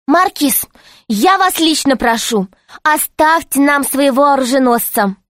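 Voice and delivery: pleading. A narrator's reading. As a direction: beg a personal favour. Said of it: "взмолилась".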